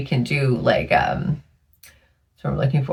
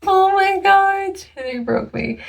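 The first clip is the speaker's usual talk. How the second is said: high, slow, drawn-out despair; exasperated; slower than usual. Real, not acted. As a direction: whine it, completely worn out. Overwhelmed after long day.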